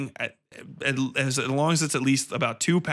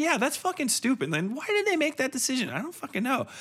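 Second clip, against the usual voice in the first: much higher, mimicking friends ripping the film apart; hammed-up scoffing, pitched way up; mocking exasperation; 12 semitones higher, faster than usual. Friends tearing apart the movie after it ends, mock exasperated. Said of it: "And like".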